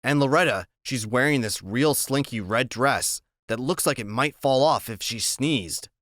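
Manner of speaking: ordinary delivery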